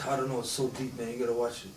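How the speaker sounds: mock clueless fan. Fan who can't explain the film, low and dreamy. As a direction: shrug it off as too deep. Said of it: "You just".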